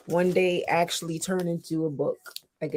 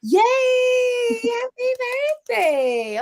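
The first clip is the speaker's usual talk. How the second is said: high, loud happy shout; cheering; 6 dB louder, slower than usual. Real, not acted. Cheering birthday wish.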